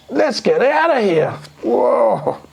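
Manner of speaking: a thief desperate to flee the stink; panicked burglar. A narrator's reading.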